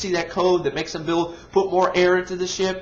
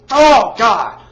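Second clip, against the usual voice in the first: a much louder fed-up oh God; exasperated groan; 2 semitones higher, 10 dB louder than usual. Real, not acted. Ranting reviewer losing patience with the film, exasperated. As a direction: let the frustration burst out. Exasperated rant about the movie.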